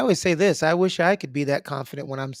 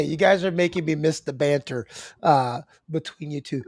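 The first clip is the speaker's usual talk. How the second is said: ordinary delivery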